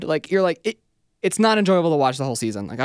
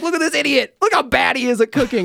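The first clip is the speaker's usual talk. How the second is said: mocking host; 10 semitones higher, 4 dB louder than usual. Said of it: "And then they're like".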